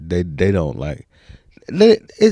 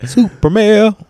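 squeaky joke voice; 14 semitones higher, 8 dB louder, much slower than usual. Pretend. Joking about mail truck.

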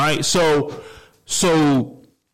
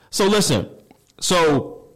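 usual speaking voice

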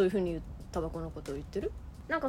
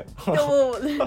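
stifled giggles; 5 dB louder than usual. Real, not acted. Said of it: "めっちゃ声を殺して笑ってるけど".

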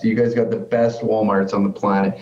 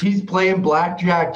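usual speaking voice

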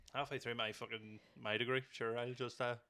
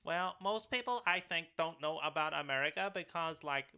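higher-pitched mock American pageant girl; ditzy American blonde; 5 semitones higher than usual. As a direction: imitate a clueless beauty queen's answer. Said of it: "it was, like, this blonde girl. She was a fucking idiot".

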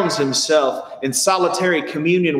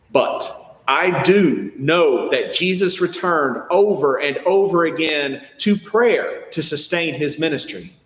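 usual speaking voice

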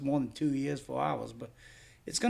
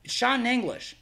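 higher, louder mock bickering; retold exasperated argument; 5 semitones higher, 4 dB louder than usual. Seller re-enacting a spat over Italian language, exasperated. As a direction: re-enact a squabble, pitched up.

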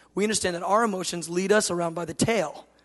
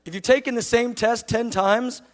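ordinary talk, nothing marked

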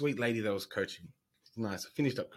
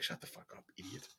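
hissed retort; 3 semitones lower, 14 dB quieter, faster than usual. Retold or imitated. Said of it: "It's like".

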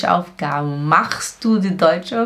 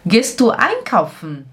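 ordinary talk, nothing marked

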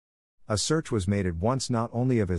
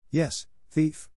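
higher-pitched, understated roar; mild angry roar; 7 semitones higher than usual. A narrator's reading. Father shaking his son by the shoulder, mild angry roar. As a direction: hurl the accusation without full volume. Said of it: "I roared".

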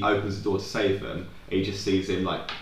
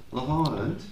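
a shaky try at an Australian accent, pitched higher; Australian accent attempt; 6 semitones higher than usual. Shown as a demonstration. Trying to voice the Australian Love Island narrator, rough Australian accent. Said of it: "I can't do an Australian accent".

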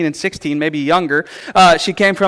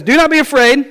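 higher, louder angelic command; booming angel; 9 semitones higher, 5 dB louder than usual. Retold or imitated. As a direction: call out to calm a frightened girl. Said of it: "she says".

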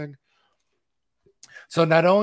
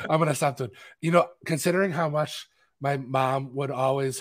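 usual speaking voice

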